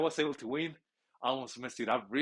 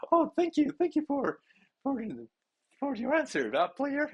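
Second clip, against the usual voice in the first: much higher, louder bubbly reporter voice; mock female reporter; 4 dB louder than usual. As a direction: chirp it like a TV sports reporter. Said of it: "And then".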